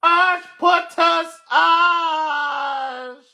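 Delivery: very slow high wail through laughter. Real, not acted. Vlogger howling the words through laughter, slow wail. Stretched cry while laughing.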